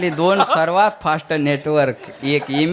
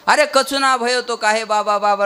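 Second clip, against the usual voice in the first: higher-pitched, rustic questioning call; acted Vrindavan dialect, pitched up; Vrindavan villager; 7 semitones higher than usual. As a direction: ask the excited girl like a Vrindavan elder. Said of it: "ही वृंदावनातली भाषा आहे".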